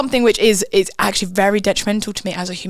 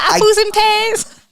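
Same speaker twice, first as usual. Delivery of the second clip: mock Cockney; 13 semitones higher, 4 dB louder, slower than usual.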